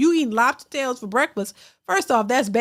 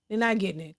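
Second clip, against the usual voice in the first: much softer, trailing echo; quiet aside; 6 semitones lower, 8 dB quieter, faster than usual. Real, not acted. Host repeats her point to the chat as a soft aside. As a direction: mutter it again under the breath.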